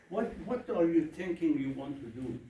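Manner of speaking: ordinary talk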